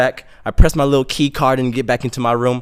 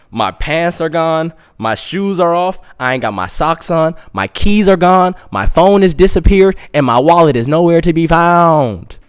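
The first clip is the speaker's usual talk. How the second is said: mounting alarm; 4 semitones higher, 5 dB louder than usual. Retold or imitated. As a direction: get higher and louder with each loss. Listing lost things, building to outcry.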